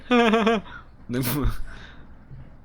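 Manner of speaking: high, drawn-out villain cackle; a hammed-up evil cackle; evil laugh. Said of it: "The fuck kind of evil laugh was that?".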